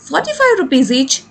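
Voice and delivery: slow, deliberate reading aloud for pupils; slow story reading. A narrator's reading. Teacher reading the story line to students, slow and clear. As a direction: read each word out clearly and slowly.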